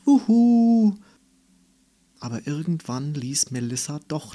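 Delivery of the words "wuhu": high loud gleeful whoop. A narrator's reading. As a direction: let out a joyful cry. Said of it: "rief sie".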